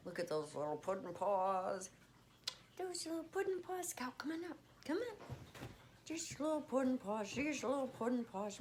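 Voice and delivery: high cooing pet talk. Real, not acted. Owner cooing at her cat's paws, high baby talk.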